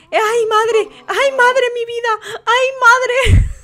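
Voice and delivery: high-pitched wailing cries; dramatic alarm. Real, not acted. Host watches a life-or-death throw, high dramatic alarm. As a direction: yelp in overexcited panic. Tense moment, life at stake.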